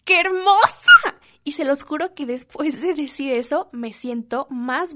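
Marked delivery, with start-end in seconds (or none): 0.0-1.2 loud, high, slow exclaim at the mirror; self-compliment. Retold or imitated. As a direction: gush at your reflection. (exclamación fuerte y aguda ante el espejo)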